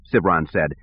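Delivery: plain reading voice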